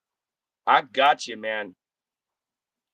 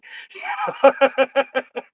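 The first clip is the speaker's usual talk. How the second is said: high laugh; 13 semitones higher, 4 dB louder than usual. Real, not acted. Laughs at own dog voice.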